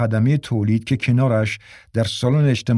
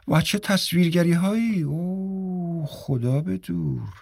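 drawn-out, softer horrified 'oh'; scandalized; 6 semitones higher, 5 dB quieter, slower than usual. A narrator's reading. Mrs. Marsten eyeing the book's illustrations, scandalized. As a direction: linger on the shock, raised pitch sinking soft and slow.

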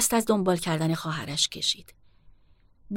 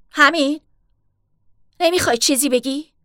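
raised, higher voice, calling after her sister; loud; 7 semitones higher, 8 dB louder than usual. A narrator's reading. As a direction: call out loudly, demanding an answer. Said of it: "با صدای بلند گفت".

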